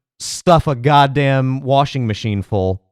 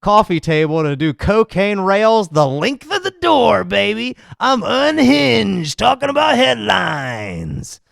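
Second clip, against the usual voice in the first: higher, hammed-up unhinged host voice, stretching words; mock unhinged rant; 4 semitones higher than usual. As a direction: play a crazed headline host, drawling "baby". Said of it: "offer your unhinged commentary".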